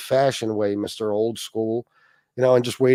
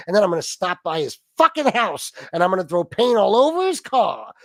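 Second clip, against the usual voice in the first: hammed-up angry tirade, building higher; mock furious rant; 4 semitones higher than usual. Rival ranting at night about F B S, mock fury. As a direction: play the rival cursing in an escalating fit.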